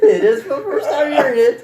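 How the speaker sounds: loud and high through laughter; laughing while talking. Real, not acted. Laughing with the group.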